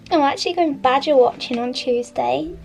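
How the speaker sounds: ordinary talk, nothing marked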